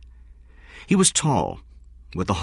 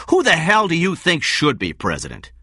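high, loud, outraged question; heated, indignant challenge; angry demand; 11 semitones higher, 5 dB louder than usual. A narrator's reading. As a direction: throw the question out in fury. Benjamin furious, still arguing.

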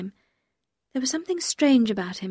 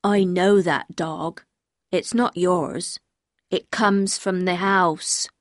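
very slow, dim-witted local man; plodding, childlike stubbornness; simple-minded man; 3 semitones lower, much slower than usual. Acted. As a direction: speak slowly as a simple fisherman. Voices the strange man.

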